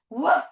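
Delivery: short playful woofs; dog bark. Pretend. Teacher being Lola on her trip, short barks.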